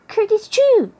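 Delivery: high, loud sudden rabbit sneeze. A narrator's reading. Rabbit hiding in the tool shed, sneezing loudly. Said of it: "Peter sneezed".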